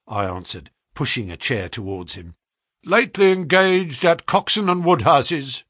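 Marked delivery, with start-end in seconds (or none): 2.8-5.6 higher, louder, quick quizzing; brisk questioning agent. A narrator's reading. Agent checks the clerk's past, brisk and prying. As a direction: fire the question like a busy agent.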